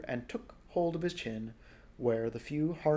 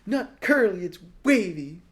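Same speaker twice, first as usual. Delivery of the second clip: indignant correction; 7 semitones higher, 10 dB louder than usual. Acted. Boy correcting narrator.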